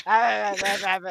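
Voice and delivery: loud wordless "ah" noises; imitated run of grunted ahs; Winston Churchill. Churchill answering a request, imitated wordless ah noises. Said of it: "then Winston Churchill goes".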